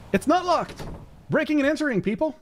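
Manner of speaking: high excited squeal of delight; gleeful high-pitched cheer. Real, not acted. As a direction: yelp it like you just got away with something. Finds the cabin door unlocked.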